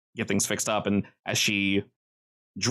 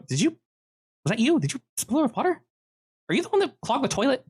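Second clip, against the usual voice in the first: very high, accusing character voice; high-pitched girl interrogating a customer; suspicious girl character; 14 semitones higher than usual. Acted. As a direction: question the suspect in a high put-on voice. Game master voices the girl.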